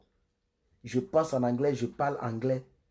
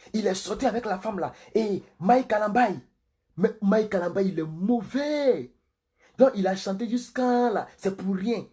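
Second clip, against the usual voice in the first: higher, worked-up scandalmonger; mock gossip broadcast; 6 semitones higher than usual. Pastor mimicking gossip videos that draw big audiences, worked-up mock outrage. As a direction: hype it like juicy scandal. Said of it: "Si je parlais comme ça là".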